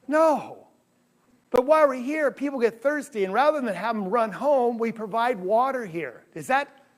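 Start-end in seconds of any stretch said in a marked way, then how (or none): none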